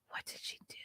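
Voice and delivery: stunned whisper. Real, not acted. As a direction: whisper it, shocked.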